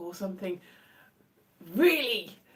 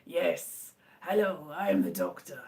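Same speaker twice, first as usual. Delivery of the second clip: low, far-from-usual actor's voice; John Hurt; 6 semitones lower than usual. Acted. Streamer with a failing voice introduces himself as the doctor, low impression. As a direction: drop low for a mock-grand introduction. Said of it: "I'm gonna sound like John Hurt".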